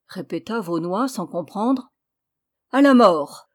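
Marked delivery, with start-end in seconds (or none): 2.7-3.5 loud ringing cry; old man's cry. A narrator's reading. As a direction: cry it out with sudden fire. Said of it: "s'écria le vieillard".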